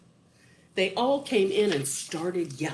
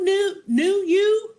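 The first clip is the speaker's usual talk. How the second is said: very high, louder, whiny; Wart; 6 dB louder than usual. Acted. Wart questions the snake, high and whiny. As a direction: squeal the repeats. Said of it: "said Wart".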